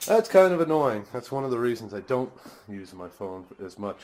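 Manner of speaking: normal talking voice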